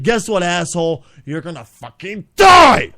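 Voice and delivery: builds from a taunt into a loud yell on the last words; mock judge yell. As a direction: play the judge bellowing a death sentence at him. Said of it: "didn't use that emphasis that should have been used".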